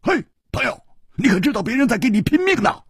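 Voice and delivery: high, rapid character voice; icy, quick and high; cold challenge. A narrator's reading. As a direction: needle a friend in a high-pitched rush. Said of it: "冷冷地说".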